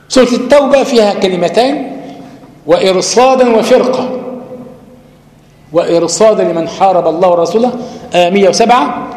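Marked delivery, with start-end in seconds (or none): none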